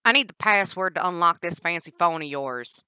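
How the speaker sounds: loud demanding captor; Danny's voice. Acted. Captor Danny to caged Jennifer, loud and demanding. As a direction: bark the demand at the prisoner. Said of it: "Danny announced".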